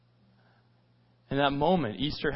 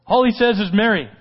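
louder, raised, marvelling delivery; emphatic amazement; 4 semitones higher, 5 dB louder than usual. Retold or imitated. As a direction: preach the one word with excitement. Preacher marvels at the single word.

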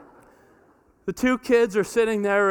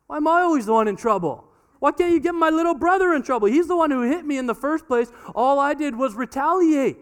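higher, louder, fast protest; whining older child; 7 semitones higher, 4 dB louder than usual. Retold or imitated. Daughter protesting to her parents, mock whiny voice. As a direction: complain it isn't fair. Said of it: "The older sibling gets tired".

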